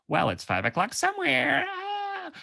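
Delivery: high, whooping party cheer; American drinker's excuse. Acted. Americans excusing an afternoon wine, high celebratory whoop. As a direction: soar up into a long, high "ah". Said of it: "Like, in America ... you go".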